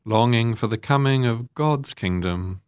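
measured liturgical reading with long pauses; formal prayer. Real, not acted. Priest leading the congregation in prayers for others, solemn and measured. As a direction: lead the intercessions in solemn, spaced phrases. Leads the intercessions.

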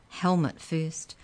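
usual speaking voice

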